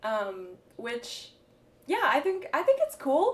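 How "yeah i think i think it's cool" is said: rising high on "I think it's cool" like a question; questioning rise. Shown as a demonstration. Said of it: "question mark".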